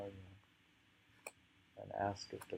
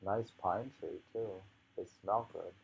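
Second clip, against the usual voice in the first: ordinary delivery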